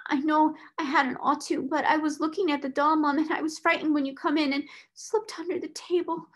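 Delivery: put-on high-pitched, anxious servant girl explaining; frightened, near tears. A narrator's reading. Scullery maid explaining why she hid, frightened. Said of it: "red with repressed crying".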